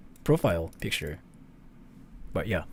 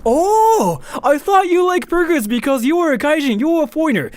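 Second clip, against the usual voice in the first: high, loud, amazed squeal; manager's high, loud translated exclamation; surprised manager; 13 semitones higher, 7 dB louder than usual. Retold or imitated. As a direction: keep the manager's shocked high voice. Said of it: "which means, like".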